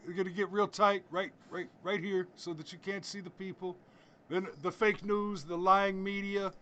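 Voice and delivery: a light send-up of a politician on stage; Donald Trump. Retold or imitated. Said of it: "he said".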